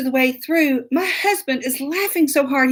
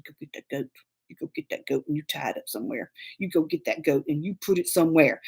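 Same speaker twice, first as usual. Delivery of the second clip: much softer, low, rushed command; urgent low order; 9 semitones lower, 9 dB quieter, faster than usual. Retold or imitated. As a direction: hiss the order fast and low so the goat can't hear. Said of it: "I said".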